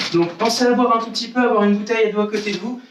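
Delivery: ordinary talk, nothing marked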